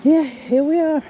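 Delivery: panting after climbing stairs; breathless, heavy audible breathing. Real, not acted. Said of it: "You can hear me breathing".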